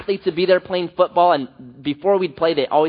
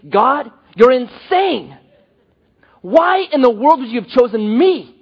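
higher and louder, slowed on God, you're insane; amazed disbelief; 5 semitones higher, 4 dB louder than usual. Pretend. Humbled believer cries out to God in loud astonishment. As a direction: marvel out loud that God would pick you.

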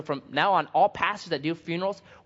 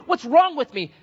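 high, loud acted desperation; imitated anguished cry; 10 semitones higher, 7 dB louder than usual. Broken sinner pleading with the pastor, mock anguished cry. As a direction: cry it out like a tormented penitent. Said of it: "they are crying and they're saying".

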